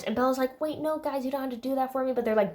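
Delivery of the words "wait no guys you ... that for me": high, soft imitation of Bella; lightly voiced timid objection; mock meek protest. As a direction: protest softly in a raised pitch.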